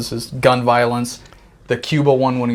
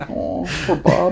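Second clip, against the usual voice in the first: mock sympathy; 6 semitones higher, slower than usual. Mock pity for Bob.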